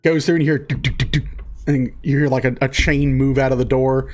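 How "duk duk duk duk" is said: imitated bolt clunks. Imitating the bolts being undone.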